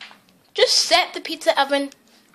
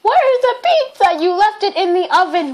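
high, loud acted yelling; exasperated shout; 5 semitones higher, 8 dB louder than usual. Mom and child over the lost pizza, exasperated shouting. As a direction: shout it across the kitchen.

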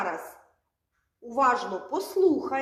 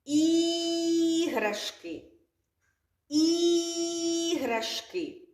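Іграшки slowly, with the І held long and higher; stretched first sound; 5 semitones higher, much slower than usual. Shown as a demonstration. Teacher stretching the word for children. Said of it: "Який перший звук?".

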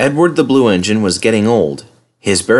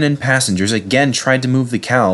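plain reading voice

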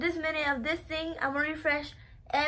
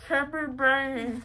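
slow, through a mouthful of marshmallows; mouth full; 3 dB louder, slower than usual. Real, not acted. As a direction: talk around a stuffed mouth.

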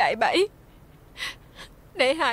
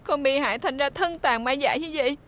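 ordinary talk

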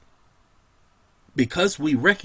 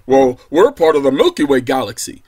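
louder, mimicking a smug astronomer; mock scientist; 2 semitones lower, 9 dB louder than usual. Preacher mimics astronomers' reply, loud and mocking. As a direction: toss off the scientists' stock answer.

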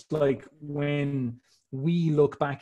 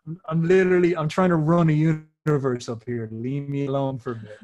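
loud mock-harried protest, easing off by the end; harried husband wanting peace, hammed up; exasperated Zeus; 6 dB louder than usual. Zeus busy running a universe, answering his wife, exasperated.

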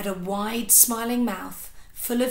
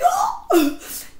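high, sharp tearful gasp; sobbing hiccup gasp; 6 dB louder than usual. Acted. Little boy gasping through tears after a nightmare, a high hiccup. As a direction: catch a breath mid-sob. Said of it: "hiccuped Bert".

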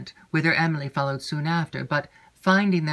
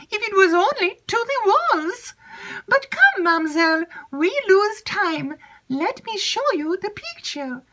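put-on much higher, eager young maid's voice; chattering maid Annette; 4 dB louder than usual. Maid Annette eagerly seeking Emily, high excited chatter. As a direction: gush it out high and breathless with news.

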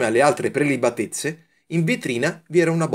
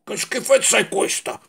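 disgusted exclamation; 9 semitones higher than usual. A narrator's reading. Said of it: "esclamò".

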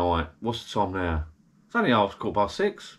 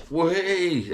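delighted whee; 9 semitones higher than usual. Retold or imitated. Entering the warm shed, delighted.

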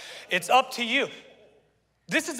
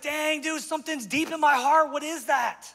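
higher-pitched mock amazement; astonished reaction; 7 semitones higher than usual. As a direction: imitate a dumbfounded guy, pitched up. Voicing other people's surprised reaction.

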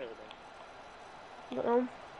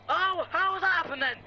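high, loud appeal to the ref; indignant protest; 11 semitones higher, 4 dB louder than usual. Real, not acted. Player appealing to the ref over a handball, indignant. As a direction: call out in raised, outraged pitch. Protesting to the referee mid game.

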